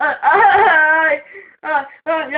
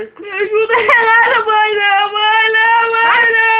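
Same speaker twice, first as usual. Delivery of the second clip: crying out for help; 3 semitones higher, 6 dB louder than usual. Real, not acted. Crying as the mother pulls.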